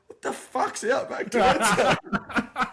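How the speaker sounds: high, slow, laughing bafflement; laughing confusion. Retold or imitated. Teammate who never heard of the podcast, laughing. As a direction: ask it cracking up, pitched well up. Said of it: "And Crackers goes".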